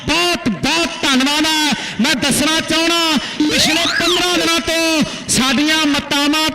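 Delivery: loud stadium play-by-play; live commentary. Acted. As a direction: announce it to the whole ground. (ਜੋਸ਼ੀਲੀ ਕੁਮੈਂਟਰੀ)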